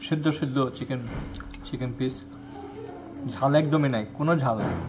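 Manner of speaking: speaking between bites of soup; chewing while talking. Real, not acted. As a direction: mouth partly full of food. Seen 0: Bite of soup chicken at -4s; lips pursed, working mouth throughout.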